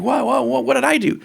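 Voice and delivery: high, startled protest; high, flustered whoa. Retold or imitated. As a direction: jump up high, caught off guard. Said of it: "Arafat's like".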